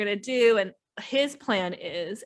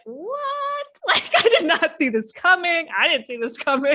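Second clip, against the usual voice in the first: mock disbelief; 6 semitones higher, 4 dB louder than usual. Girlfriend stunned by the plan.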